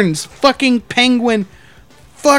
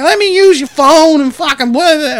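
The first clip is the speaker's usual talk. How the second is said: higher, louder drawn-out demand; imitated drunk man; 5 semitones higher, 4 dB louder than usual. Drunk man demanding the manager's phone, lightly imitated. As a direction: lightly mimic a pushy drunk. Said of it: "this fucking drunk motherfucker".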